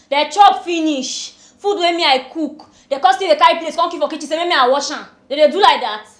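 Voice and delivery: loud, emphatic grievance; indignant complaint. Acted. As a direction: protest each point forcefully to the madam. Indignant complaint about children to madam.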